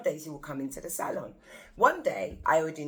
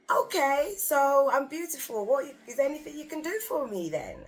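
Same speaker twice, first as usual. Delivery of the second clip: higher, louder, cheeky request; cheeky ask; 10 semitones higher, 5 dB louder than usual. Retold or imitated. Receptionist boldly asking the star for help, cheeky. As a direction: push your luck playfully.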